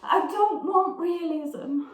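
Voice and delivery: slow, high-pitched theatrical protest; Blanche. Acted. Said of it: "I will be Blanche".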